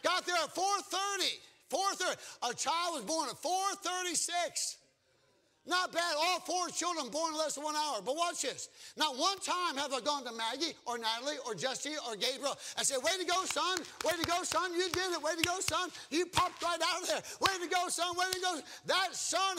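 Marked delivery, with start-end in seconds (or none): 0.0-12.6 high, raised preacher's voice; full-force preaching. Acted. Preacher builds the birth story to its point, full-force preaching. 13.0-18.6 high, loud cheering on; hammed-up proud cheer; mock congratulation. Father pretends to congratulate his newborn on being born, mock cheering.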